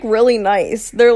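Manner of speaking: normal talking voice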